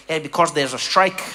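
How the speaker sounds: lightly imitated not-quite-Dutch accent; blunt answer with a touch of a Dutch-like accent; odd Dutch-like accent. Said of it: "like, Dutch, but it wasn't Dutch accent".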